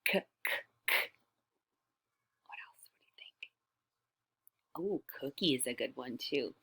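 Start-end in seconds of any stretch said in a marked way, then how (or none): 0.0-0.9 k, k, k breathed out with no voice; whispered k sound. Shown as a demonstration. Teacher whispering the k sound again. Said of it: "the k sound".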